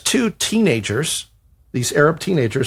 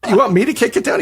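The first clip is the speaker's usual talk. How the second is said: incredulous; 9 semitones higher than usual. Retold or imitated. Writer balking at the agent's dare, high and incredulous. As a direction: voice jumps high in disbelief.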